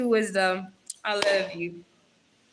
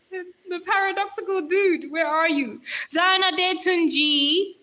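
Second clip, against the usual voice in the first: loud, raised playful summons; weird roll call; 5 semitones higher, 4 dB louder than usual. Real, not acted. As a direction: announce the names like an over-the-top register. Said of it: "that sounded so weird".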